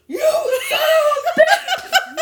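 high, loud, slow laughing yell; startled shout. Retold or imitated. Startled by husband at the door, laughing shout. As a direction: scream it out, half laughing.

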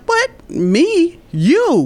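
acted-out "who, me?" disbelief; disbelieving. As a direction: bounce the compliment back in astonishment. Flustered reaction to friend's praise.

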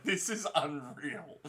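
slow, laughing disbelief; amazed. Retold or imitated. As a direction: say it slowly in awe. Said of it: "I was just like".

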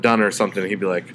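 ordinary talk, nothing marked

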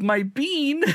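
very high adoring squeal; cutesy pet talk. Acted. Owner using nicknames for his cat, cutesy cooing. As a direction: gush over a precious pet.

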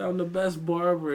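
loud bragging barber shout; boastful call. Retold or imitated. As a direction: belt it out like bragging. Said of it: "He said".

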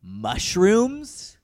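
rising, slowing, incredulous questions; mounting surprise. Real, not acted. Escalating mock shock.

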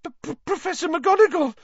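put-on frightened girl gasping out a name; gasping in fright. A narrator's reading. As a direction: stammer it out in terror. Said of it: "Hermione gasped".

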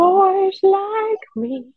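very high, slow falsetto; squeaky mock voice, drawn out; high silly voice. Echoing the falsetto joke.

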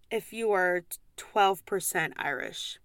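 usual speaking voice

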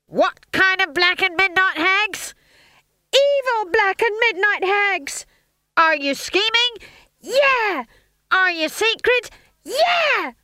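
very high, loud shouted chant; rallying call and response. A narrator's reading. Stage manager and witches working up frenzy, shouting. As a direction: whip it up like a pep rally.

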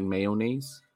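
"mayonnaise" stretched to may-oh-naise, rising; imitating his mayonnaise. Teasing a cohost about his word, drawn-out may-oh-naise. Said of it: "You were saying, like".

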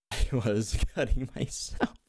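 slow, breaking into real laughter; laughing. Real, not acted. Storyteller recalling the seller's mistake, laughing. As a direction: laugh through the words as they come out. Laughs while telling the misunderstanding.

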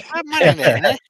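put-on high-pitched copy of an Italian accent; mock Italian accent. Echoing the demonstrated one line, playful Italian accent. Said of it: "Just say that".

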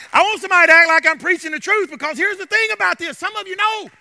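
loud, driving sermon delivery; fervent preaching. Real, not acted. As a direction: preach it with mounting conviction, punching key words. Rallies congregation to clap and listen.

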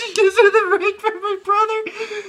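high, slow, crying with laughter; laughing. Real, not acted. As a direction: gasp it out laughing. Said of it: "laughing while retelling".

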